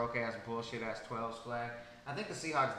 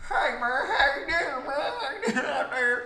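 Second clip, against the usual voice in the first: hammed-up Southern yokel impression; Larry the Cable Guy; 13 semitones higher, 14 dB louder, slower than usual. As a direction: holler like a cartoon hillbilly. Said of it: "Fucking Larry the Cable Guy".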